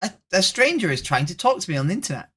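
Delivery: high, fast mock voice of a nerdy stranger; whiny mock-nerd voice, slowing into the dwarf intro; mocking a roleplay nerd. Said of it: "I hate it when people are like".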